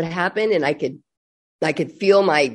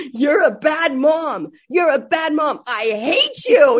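mock child yelling; 7 semitones higher, 4 dB louder, slower than usual. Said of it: "then he started berating her".

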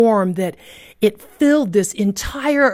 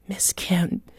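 soft breathy whisper of her name; boy whispering to his teacher; 3 semitones lower, 7 dB quieter than usual. Retold or imitated. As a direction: hush it tenderly. Boy leans down and speaks.

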